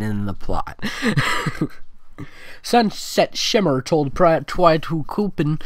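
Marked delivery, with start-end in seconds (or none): none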